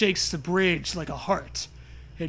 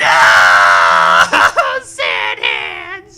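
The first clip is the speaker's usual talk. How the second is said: loud, low anguished howl; despairing shout; 13 semitones lower, 14 dB louder, much slower than usual. Pretend. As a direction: wail about the sand hands. Mock despair.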